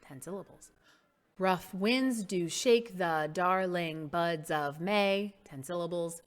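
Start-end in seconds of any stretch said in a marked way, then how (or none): 1.4-5.3 slow, syllable by syllable; slow measured recitation. Shown as a demonstration. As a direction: draw the verse out for counting syllables. Reciting line to count syllables.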